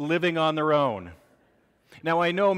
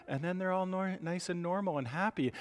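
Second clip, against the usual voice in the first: normal talking voice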